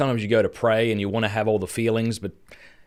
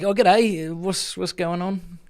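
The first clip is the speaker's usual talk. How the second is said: nervous g'day; 7 semitones higher, much slower than usual. Retold or imitated. Nervous bloke greeting.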